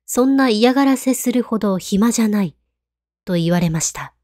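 usual speaking voice

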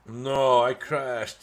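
shouted frustration at crashing; loud dismay. Real, not acted. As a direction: yell it out as the game crashes. Crash in game.

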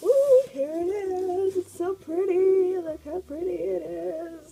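high, drawn-out excited squeal; thrilled, sing-out cooing over something pretty. Real, not acted. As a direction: gush slowly in a very high voice. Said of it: "I'm so excited".

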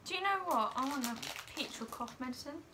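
ordinary talk, nothing marked

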